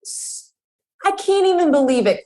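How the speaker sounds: outraged disbelief; shocked disbelief. Real, not acted. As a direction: gasp out your disbelief. Outrage at ingredients.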